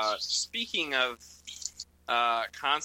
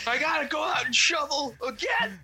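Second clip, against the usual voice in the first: high shouted grumpy complaint; grumpy dad voice; 13 semitones higher, 3 dB louder than usual. Retold or imitated. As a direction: whine like a cranky suburban dad. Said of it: "imitating grumpy dad".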